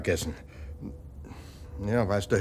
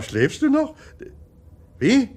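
usual speaking voice

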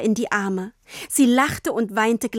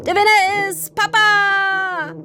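much higher, louder, slow victory cry; triumphant shout; 11 semitones higher, 5 dB louder, slower than usual. A narrator's reading. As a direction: crow the win with arms up. Father triumphs, arms raised. (Papa jubelt laut über seinen Sieg)

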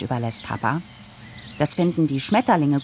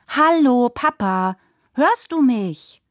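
put-on much higher, slow girl's call; calling out to dad; 9 semitones higher, 3 dB louder, slower than usual. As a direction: try to break through to a distracted father. Daughter tries to get Papa's attention. (laut rufendes Mädchen, viel höher)

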